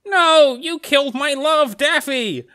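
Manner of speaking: high, loud melodramatic lament; Bugs Bunny. Acted. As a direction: wail in hammed-up heartbreak. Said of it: "And then Bugs goes".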